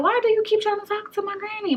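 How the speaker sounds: high, sharp, annoyed question; irritated self. Retold or imitated. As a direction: call out the nosy auntie. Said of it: "And I was like".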